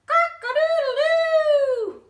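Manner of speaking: high, loud and slow; big crow. A narrator's reading. Narrator voicing Bob's big crow at the fox. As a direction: let it ring out.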